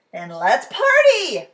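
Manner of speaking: slow booming party call. A narrator's reading. Ted rallying the boy to party, booming. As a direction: boom it out like a big friend.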